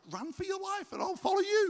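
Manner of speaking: high, loud pretend urgency; urgent mock cry. Preacher acting out the sensible plan for the congregation, urgent mock cry.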